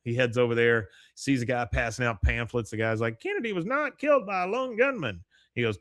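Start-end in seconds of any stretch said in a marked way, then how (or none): 3.2-5.2 a much higher put-on voice for the pamphlet guy; pamphlet conspiracy guy. Pamphlet guy tells Dale the truth, much higher put-on voice. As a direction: squeak out the conspiracy pitch.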